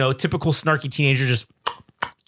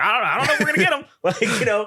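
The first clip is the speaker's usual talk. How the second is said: fast, higher, fretting mock soldier; nervous doubt; 8 semitones higher, faster than usual. Soldier doubting they can hit the sniper, fast and high. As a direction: rattle it off high and anxious. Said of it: "So he's like".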